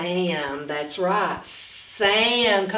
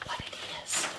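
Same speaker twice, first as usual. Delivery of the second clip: hushed suspense question; whisper; 12 dB quieter, faster than usual. Acted.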